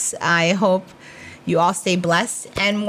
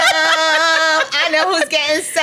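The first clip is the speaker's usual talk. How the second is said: slower teasing repeat; 7 semitones higher, much slower than usual. Retold or imitated. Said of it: "I'm like".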